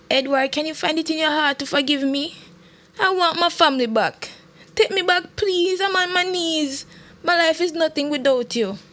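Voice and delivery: high-pitched begging voice; desperate pleading. A narrator's reading. Wife begging her husband to take her back, pleading. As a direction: plead as if on your knees.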